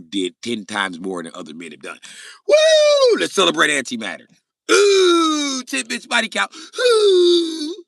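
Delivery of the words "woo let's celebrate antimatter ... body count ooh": very high, loud mock cheering; whooping celebration. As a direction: whoop it up in piercing falsetto. Mock cheering for the body count.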